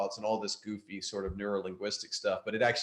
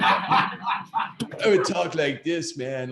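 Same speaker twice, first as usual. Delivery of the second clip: slow, drawn-out, higher stoner voice with a laugh; Spicoli stoner voice; 7 semitones higher, 5 dB louder, much slower than usual. Retold or imitated. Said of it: "if I talked like a stoner".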